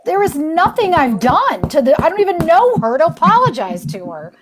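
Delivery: retold protest, high and insistent; indignant protest. Retelling a refusal to apologize to a stranger, indignant. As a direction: push the pitch up, mildly outraged.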